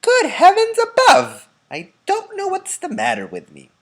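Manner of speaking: put-on an old man shocked at himself; loud startled elderly voice; Grandpa Joe exclaiming. A narrator's reading.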